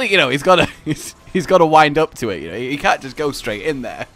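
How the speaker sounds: ordinary talk, nothing marked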